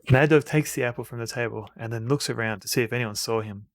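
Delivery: normal talking voice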